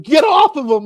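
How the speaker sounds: high, loud mock yell. Retelling the cameraman shouting at the gator, loud mock yell. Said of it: "he's literally telling him".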